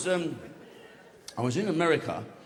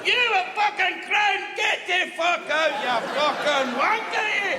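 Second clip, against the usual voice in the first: taunting shout; 11 semitones higher than usual. Acted. Little Scotsman jeering at the English army, high taunting shout. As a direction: bellow insults high from a hilltop.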